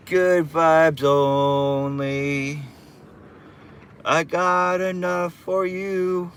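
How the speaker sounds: slow, high, stretched "Good vibes only"; drawn-out catchphrase. Acted. Telling his stream viewers, slow and high-pitched. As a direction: stretch it out like a chill mantra.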